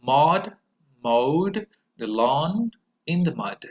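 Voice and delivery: slow, with a stressed Maud; careful model for repetition; slow model sentence. Shown as a demonstration. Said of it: "pronounce them after me".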